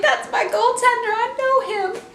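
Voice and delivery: high-pitched proud fan claim; excited bragging. Retold or imitated. Fan hears Pekka Rinne's name and claims him, excited.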